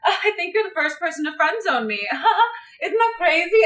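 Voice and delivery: high, laughing mimicry; high-pitched mock giggly brag; mock boasting. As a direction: imitate her gushing, pitched well up. Mimics her bragging to him.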